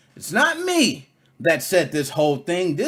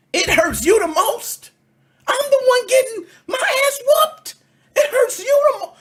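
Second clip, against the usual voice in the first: high, loud mock cry of a self-pitying general; mocking whiny general; 4 dB louder than usual. Mocking a general who whines he is hurt most.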